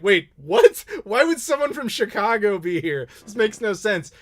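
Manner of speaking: loud, high mock disbelief; exaggerated incredulous outburst; baffled audience. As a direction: voice a whole crowd's bewilderment. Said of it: "The entire audience turns around 'cause they're like".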